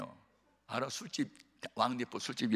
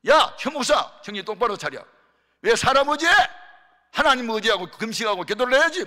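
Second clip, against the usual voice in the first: loud, much higher scolding; 10 semitones higher, 12 dB louder than usual. Retold or imitated. Senior pastor scolding Pastor Choi for asking for money. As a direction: tear into him like a stern mentor. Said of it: "막 그러면 야단치는 거예요".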